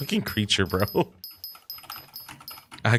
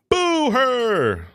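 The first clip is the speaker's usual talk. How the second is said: high loud drawn Boo her; mock rallying boo; 14 semitones higher, 4 dB louder than usual. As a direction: shout it like the meme cook. Repeats meme line.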